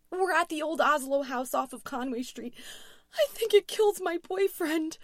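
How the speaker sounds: high, loud, tearful panic; shaking scream. A narrator's reading. Louise reporting the attack to dispatcher, panicked. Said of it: "voice was shaking as she screamed".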